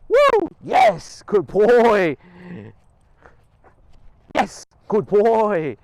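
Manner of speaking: very high, loud whoop and praise; thrilled whoop. Real, not acted. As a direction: celebrate the dog like a win. Dog completes the run.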